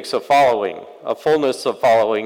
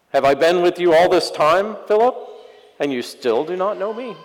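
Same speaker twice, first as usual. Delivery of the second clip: raised, exasperated sarcasm toward Philip; Jesus sarcastically chiding Philip, higher and louder; sarcastic Jesus; 7 semitones higher, 4 dB louder than usual. Acted. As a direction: needle him with a disbelieving rise. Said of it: "here's the sarcasm".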